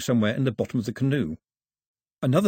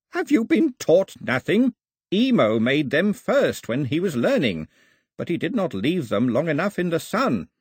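put-on scolding old priest; 4 semitones higher than usual.

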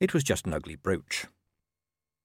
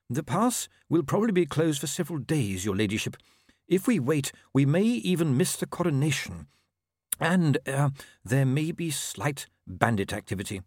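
higher-pitched, deferential official; Inigo, cautioning; 5 semitones higher than usual. Acted. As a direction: break the risk delicately to her ladyship. Inigo warns her ladyship.

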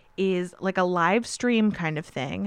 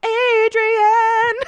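much higher, loud hammed-up yell of "Adrian!"; Stallone's Rocky yell; 7 dB louder than usual. Podcast host doing Rocky's ending, a loud hammed-up "Adrian!" yell. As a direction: belt the name like Rocky at the final bell. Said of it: "when he's like".

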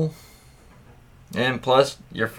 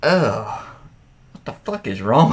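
ordinary delivery

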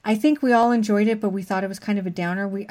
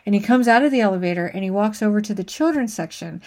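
usual speaking voice

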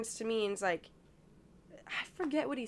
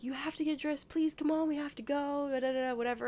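higher-pitched retold pleading; imitated urging grandmother; 6 semitones higher than usual. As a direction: voice a grandmother hurrying a child along.

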